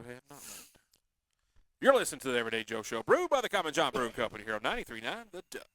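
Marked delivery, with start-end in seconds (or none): 1.8-5.7 loud, brisk announcer read; radio host throwing to break; radio station ID. Acted. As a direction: punch out the show's sponsor tag.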